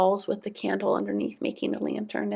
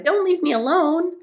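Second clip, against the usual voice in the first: high-pitched, pleading call; shouted plea; 9 semitones higher, 5 dB louder than usual. A narrator's reading. Moomin Troll alone in the dark wood, shouting a plea. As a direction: call out high, begging them to stay. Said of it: "he shouted".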